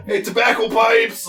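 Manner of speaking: calling out. Acted. Vendor calling out wares.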